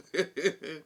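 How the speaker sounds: laughing through the words; mock giggle at the reply; imitating a laugh. Vlogger retelling Garcelle's reaction, laughing along. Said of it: "Garcelle started laughing".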